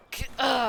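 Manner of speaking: spat crash noise with a high, loud yelp; pretend impact cry. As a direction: play at taking a blow. Figure is hit in play fight.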